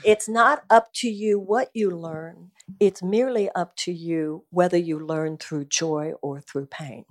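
emphatic repeat. Real, not acted. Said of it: "Say it again for everybody".

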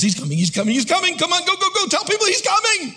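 building from urgent chant to high frantic cry; urgent excitement. Acted. Early believers urging each other to tell people, building excited urgency. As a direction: spread breathless news, pitch climbing steeply. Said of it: "there's this constant influence".

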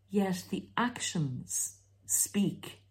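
whisper. Real, not acted.

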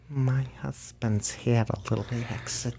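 very soft, hushed wife voice; imitated wife. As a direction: mimic her breaking awkward news under her breath. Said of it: "his wife's only response was".

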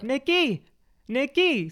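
high-pitched "Nicky", punched on the KY; "Nicky" with the end leaning up high; stress on second syllable. Retold or imitated. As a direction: imitate them calling nick-KEE. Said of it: "the emphasis on the second syllable".

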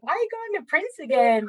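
high, whiny-style mock question; mocking imitation. Real fan mimics eye-rolling acquaintances, mocking.

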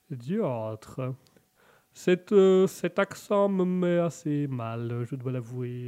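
understated English lord, put-on accent. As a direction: hesitate politely in a bad English accent. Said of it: "monsieur Malone qui sera l'anglais".

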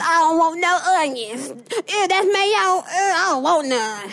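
high, whiny picky-eater imitation; whiny baby voice. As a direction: whine like a fussy kid. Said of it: "He's a baby".

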